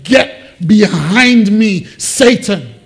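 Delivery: loud, slow, commanding rebuke; Jesus. Acted. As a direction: stretch each word into a forceful command. Acts Jesus rebuking Peter.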